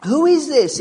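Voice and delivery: disciples asking. Retold or imitated. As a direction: disciples in the boat ask about Jesus, astonished. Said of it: "we left them asking".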